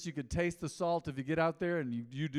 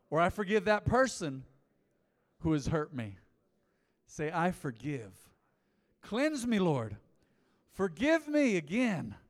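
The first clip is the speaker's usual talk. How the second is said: high, loud fervent prayer; prayer leading; 4 semitones higher, 7 dB louder than usual. Acted. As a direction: lead the house in prayer with fervour. Leads repeat-after-me prayer.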